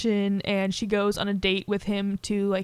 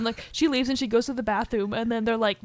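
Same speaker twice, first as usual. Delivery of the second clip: ordinary talk, nothing marked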